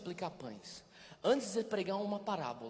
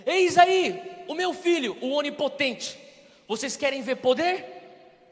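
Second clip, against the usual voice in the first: booming mock God voice; 7 semitones higher, 14 dB louder than usual. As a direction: announce it like a showy herald. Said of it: "Ele não falou isso".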